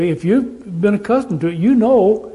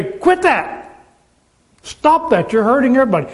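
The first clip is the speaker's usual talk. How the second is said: mock scolding; 4 semitones higher than usual. Preacher voicing a scolder.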